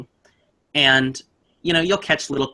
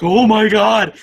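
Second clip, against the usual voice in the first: high, louder shocked cry, imitated; shocked gasp; 10 semitones higher, 8 dB louder, much slower than usual. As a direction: mimic the sister's tearful shock. Sister hears the ghost recording.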